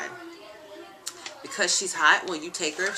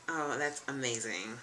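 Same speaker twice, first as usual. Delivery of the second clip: normal talking voice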